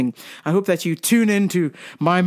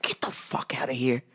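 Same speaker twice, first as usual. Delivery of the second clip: hushed, breathy aside of disbelief; whisper; 8 semitones lower, 10 dB quieter than usual. Real, not acted. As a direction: whisper it like you can't believe it.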